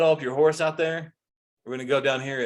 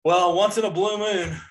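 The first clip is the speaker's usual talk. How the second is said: mock quote; 6 semitones higher, 4 dB louder than usual. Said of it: "what's the other one".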